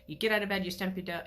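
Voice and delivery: ordinary talk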